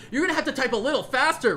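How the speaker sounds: loud high taunt read from her own message. Retold or imitated. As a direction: gloat as you read it out. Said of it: "I love this one".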